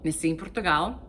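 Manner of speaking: nasci em Portugal in native Portuguese. Shown as a demonstration. Answering a viewer about being bilingual, in Portuguese. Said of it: "So I was born in Portugal".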